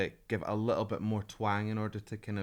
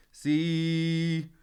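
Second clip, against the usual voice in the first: "See" sung bright and higher with the larynx held high; 6 semitones higher, 6 dB louder than usual. Shown as a demonstration. Coach shows the singer's high bright way to sing See. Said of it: "it's more".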